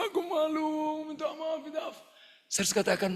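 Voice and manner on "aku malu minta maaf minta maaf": high, fading plea with a mock sob; man hugging the pastor and apologizing, in mock tears; retold crying apology. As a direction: beg forgiveness through tears, lightly acted. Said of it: "dia nangis, nangis".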